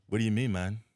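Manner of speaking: imitated Jamaican accent, lightly put on; mock a rapper's Jamaican accent, breaking into laughter; retold line in a light Jamaican accent. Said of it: "where's the Jamaican accent coming from".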